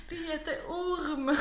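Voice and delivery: slow, loud imitation of Småland dialect. Dad corrects daughter's pronunciation, slow mock Småland dialect. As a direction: correct the word in hammed-up Småland speech, laughing. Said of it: "då blev det mycket småländska".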